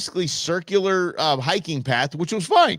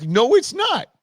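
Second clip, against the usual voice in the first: much higher, louder mock protest; playful denial; 11 semitones higher, 4 dB louder, slower than usual. Husband teasingly denying the first summit kiss to his wife, playful denial.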